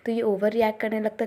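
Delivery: normal talking voice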